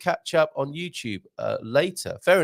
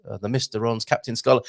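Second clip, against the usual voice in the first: ordinary delivery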